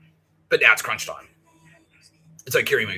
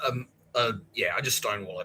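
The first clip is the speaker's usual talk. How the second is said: ordinary talk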